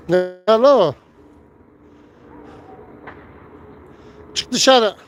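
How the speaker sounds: high, loud scolding yell; angry shout. Real, not acted. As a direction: order the bird out, yelling. Yelling at a bird to get out.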